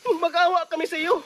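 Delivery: pleading with Commander Gine, mock tearful; mock crying plea. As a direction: beg her not to go back, near tears. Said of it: "Iyak talaga yan".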